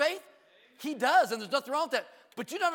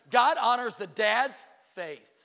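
slow and louder, every word weighted; slow emphatic punchline; 5 dB louder, slower than usual. Shown as a demonstration. Preacher driving his point home to the church, slow and loud. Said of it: "And God, ready?".

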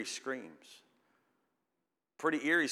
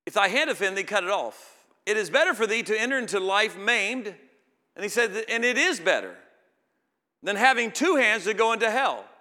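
much higher and louder preaching; full-force preaching; 9 semitones higher, 11 dB louder than usual. Acted. Preacher thundering at the congregation about the deterrent. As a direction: drive the warning home at full force.

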